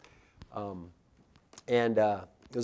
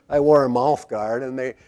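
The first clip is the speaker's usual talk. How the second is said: ordinary talk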